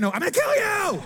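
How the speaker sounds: high-pitched mock yell at the kids; mock shout. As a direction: snap like a fed-up parent. Parent snapping at nagging kids.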